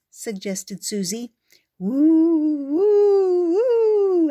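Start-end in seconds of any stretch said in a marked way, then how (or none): 1.8-4.3 rising, very high, loud spooky woo; ghost howl. Acted. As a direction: howl like a ghost, climbing higher. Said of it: "howled the ghosts".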